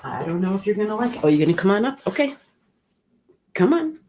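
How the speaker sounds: high, eager talk to an animal; pet coaxing. Real, not acted. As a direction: invite the pet up in a high, lifted voice. Coaxing her pet to come up.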